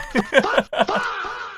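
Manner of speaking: real, high-pitched cackling laugh. Real, not acted. Laughing after greeting viewers.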